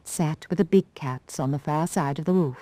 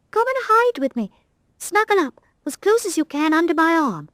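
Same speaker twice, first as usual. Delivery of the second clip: higher, coaxing motherly voice; 12 semitones higher than usual. Acted. Rag doll coaxes the scared cat, motherly. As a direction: gather a frightened kitten in. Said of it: "motherly instincts were roused".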